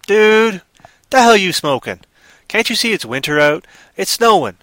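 a fed-up farmer snapping at a drifter; gruff farmer. Acted. Farmer scolds the stranger.

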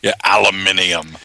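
British aluminium. Retold or imitated. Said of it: "It's aluminum".

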